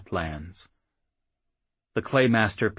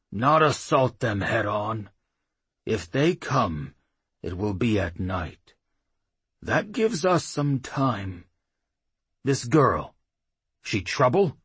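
put-on gruff old chief; 3 semitones higher than usual. A narrator's reading. Claymaster musing aloud.

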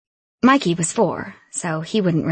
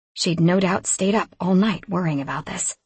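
ordinary delivery

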